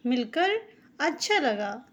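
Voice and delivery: ordinary talk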